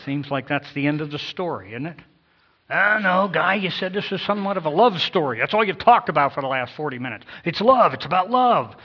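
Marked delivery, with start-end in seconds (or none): 2.7-8.8 higher, louder protest; a churchgoer protesting to the preacher; objecting listener. Acted. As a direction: playfully protest the grim ending.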